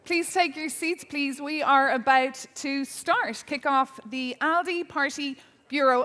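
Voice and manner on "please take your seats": normal talking voice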